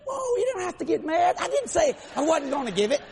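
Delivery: high, hurried-to-object mock alarm; defensive protest. Preacher backing down before God, high defensive protest.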